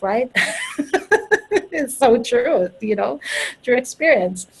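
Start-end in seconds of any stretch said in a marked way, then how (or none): 0.4-1.7 real high-pitched laugh; high burst of laughter. Real, not acted. Speaker laughing at her own fears, high laugh. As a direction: laugh out at yourself. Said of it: "I'm laughing because I'm laughing at myself".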